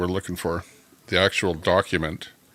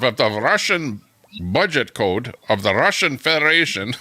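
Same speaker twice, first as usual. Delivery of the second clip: put-on Putin with a Russian accent; 5 semitones higher than usual. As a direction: read it like a Russian leader's decree in a put-on Russian accent. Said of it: "I'll read it in Russian, with a Russian accent".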